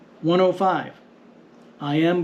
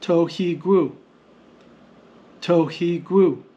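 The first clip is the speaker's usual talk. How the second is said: "tohigru" measured out in even syllables; no marked stress; slower than usual. Shown as a demonstration. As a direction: sound out "tohigru" as to, hi, gru, evenly. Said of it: "The standard transliteration shows us".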